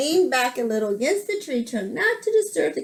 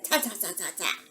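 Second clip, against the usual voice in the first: soft, staccato monkey noises; quiet storybook monkey chitter; monkey chatter; 4 semitones lower, 9 dB quieter, faster than usual. A narrator's reading. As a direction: imitate mocking monkeys in little repeated syllables. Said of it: "monkeys only stamped both their feet".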